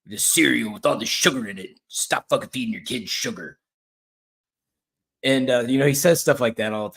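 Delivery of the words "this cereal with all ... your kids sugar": lightly imitated aggressive bodybuilder rant; mock-scold parents like an aggressive bodybuilder. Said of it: "he talks really aggressive".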